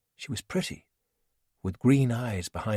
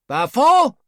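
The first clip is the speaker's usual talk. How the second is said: much higher, much louder call; shout from afar; 12 dB louder than usual. Acted. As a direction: yell his name across the village. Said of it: "someone shouted from inside a hut".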